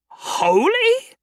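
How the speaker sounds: very high shocked question; shocked disbelief. Acted. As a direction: gasp it out in disbelief. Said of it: "he asked".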